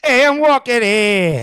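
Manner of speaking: loud, drawn-out New Yorker shout; New York accent. Shown as a demonstration. Showing off New York to co-hosts, loud mock New York accent. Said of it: "that's New York, isn't it?".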